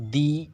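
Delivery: The with an ee vowel before Enchanted; THE pronounced "dee". Shown as a demonstration. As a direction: say The as "dee", with a long ee. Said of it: "दी पढ़ेंगे".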